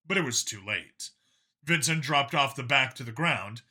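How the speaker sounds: ordinary delivery, nothing marked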